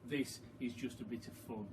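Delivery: ordinary delivery, nothing marked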